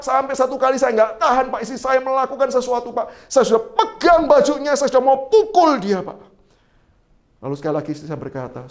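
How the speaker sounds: normal talking voice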